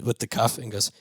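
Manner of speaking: usual speaking voice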